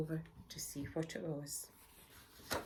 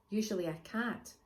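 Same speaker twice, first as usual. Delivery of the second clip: ordinary delivery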